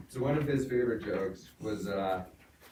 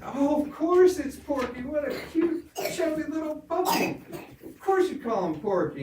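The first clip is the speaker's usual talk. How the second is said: much higher, loud knowing preacher; hammed-up preacher; 7 dB louder than usual. Preacher gushing about the chubby puppy, high and loud. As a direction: gush over the chubby puppy. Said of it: "they said".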